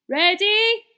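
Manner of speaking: very high, loud shout; shouted ready. A narrator's reading. Percy shouting to the rescuers lined up behind him. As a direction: call out to the team. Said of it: "shouted Percy".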